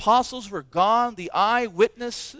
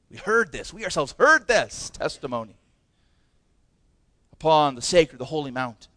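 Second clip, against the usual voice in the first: normal talking voice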